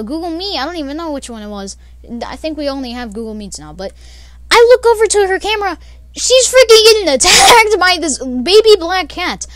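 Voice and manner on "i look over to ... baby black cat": excited retelling. Real, not acted. Excited retelling of cat attack.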